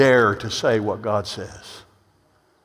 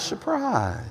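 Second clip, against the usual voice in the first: ordinary delivery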